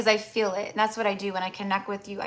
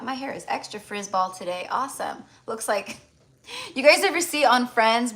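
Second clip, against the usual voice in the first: usual speaking voice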